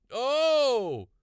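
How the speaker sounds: sudden high loud yelp of shock; startled cry. A narrator's reading. Farmer startled by floating tomatoes, high shocked cry. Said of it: "he cried".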